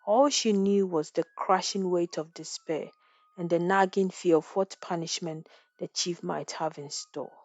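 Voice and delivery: usual speaking voice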